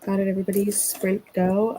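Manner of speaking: normal talking voice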